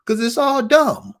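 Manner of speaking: exasperated loud climax to the rant; heated outburst. Real, not acted. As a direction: blow up at the listeners in frustration.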